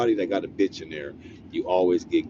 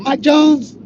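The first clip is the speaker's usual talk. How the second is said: surprised mishearing; 11 dB louder than usual. Retold or imitated. Guard mishears name, surprised.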